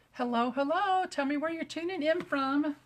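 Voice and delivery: a hint of a chirpy talk-show host; playing TV host to the viewers. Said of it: "that sounds like we're really on T V".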